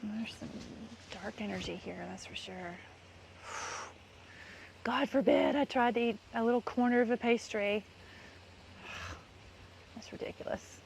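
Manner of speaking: usual speaking voice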